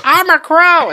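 high squawky shout of a crow; silly crow cry. Pretend. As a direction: shriek it like a stoned bird. Acting out a stoned cameraman.

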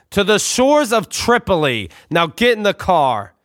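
high, slow, stiff father command; stern dad voice. Retold or imitated. Father ordering his son into the car, slow and stern.

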